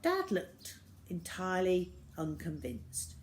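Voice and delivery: ordinary delivery, nothing marked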